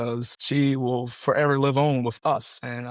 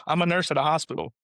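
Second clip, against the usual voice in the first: normal talking voice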